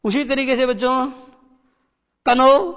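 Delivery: usual speaking voice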